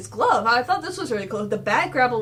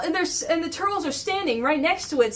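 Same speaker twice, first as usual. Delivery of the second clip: usual speaking voice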